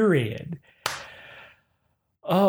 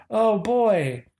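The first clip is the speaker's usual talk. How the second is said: exasperated; 6 semitones higher than usual. Real, not acted. Reaction to email.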